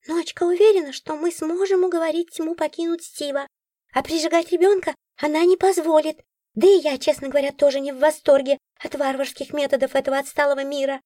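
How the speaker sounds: high little creature voice, matter-of-fact; a prim magical critter lecturing; Nora's voice. A narrator's reading. Nora explaining the cat's plan, high prim.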